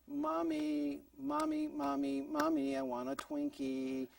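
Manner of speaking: high, slow, drawn-out whine; whining child. Retold or imitated. Child begging mom for a Twinkie, whining. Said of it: "When you hear that voice".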